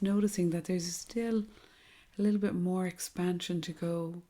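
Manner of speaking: soft, hushed meditation voice with long pauses; guided meditation. Acted. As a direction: guide them gently through each breath, softly and unhurriedly.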